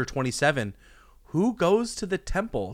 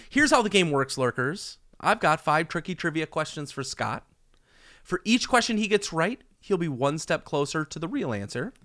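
put-on booming kids show opener; 4 semitones higher than usual. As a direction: pump up the audience like a host. Hosts game show segment.

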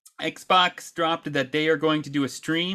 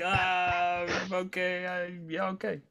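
awkward soft okay; 3 semitones higher, 7 dB quieter, slower than usual. Retold or imitated. Dad flustered after son's remark.

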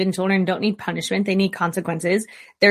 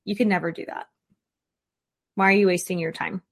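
dismissive critic; 2 semitones lower, 4 dB quieter, faster than usual. Retold or imitated.

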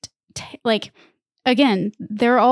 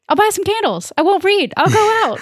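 high-pitched mock mom yell; 10 semitones higher, 4 dB louder than usual. Rob's mom calls from the other room, mock yell. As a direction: holler it like a mom from another room. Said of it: "from the other room, she's yelling".

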